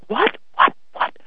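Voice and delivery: high, loud, incredulous cry; imagined shout of disbelief at mass; indignant outburst. Pretend. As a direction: leap up and protest at the priest. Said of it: "I almost wanted to leap up and say".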